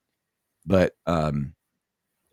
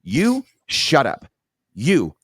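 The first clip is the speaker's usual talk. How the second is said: slow, higher, pointed telling-off; sharp rebuke; 4 semitones higher, 3 dB louder, slower than usual. Retold or imitated. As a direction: retell turning on someone, punching each phrase. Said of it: "I turned on him quick".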